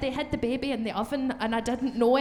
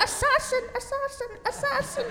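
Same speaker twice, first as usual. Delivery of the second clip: very high eerie repeated assassin; high theatrical whisper-chant; dramatic reenactment; 14 semitones higher than usual. Retold or imitated. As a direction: chant it like a spooky child. Child reenacting film scene.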